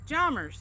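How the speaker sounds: jommers said high and loud, no p-sound; playful quote of a Southern mom; imitating jommers. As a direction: mimic a friend's mom's jommers. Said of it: "friend of mine's mom used to say jommers".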